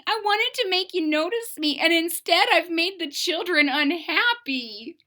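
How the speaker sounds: put-on high, slightly tearful child voice; tearful Maggie. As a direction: confess sorrowfully to mama. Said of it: "said Maggie".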